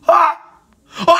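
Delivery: short, high, loud shrieks; startled scream. Real, not acted. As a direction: shriek twice in sudden fright. Startled by the beast in game.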